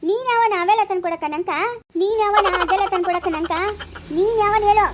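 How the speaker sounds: indignant challenge building to a loud shout; angry demand. Acted. Wife confronts offensive caller.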